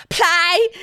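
high, loud Brummy demand; imitating a drunk Birmingham friend; mock Brummy plea; Brummy drunk mate. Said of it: "Brummy accent".

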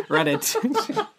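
a silly high-pitched giggle on the word; high laughing squeal. Pretend. Joking repetition of the title.

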